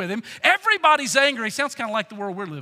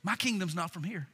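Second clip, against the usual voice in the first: soft, low, untroubled statement; serene, hushed reply; absolute peace; 5 semitones lower, 11 dB quieter than usual. Acted. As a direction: let it drop quietly and calmly. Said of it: "in absolute peace, says".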